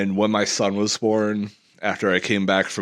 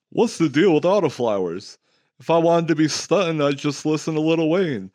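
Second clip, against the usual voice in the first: higher stand-up comic voice; generic stand-up comedian; 9 semitones higher than usual. Acted. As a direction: deliver it like a club comic doing a bit. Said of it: "generic comedian number three kind of thing".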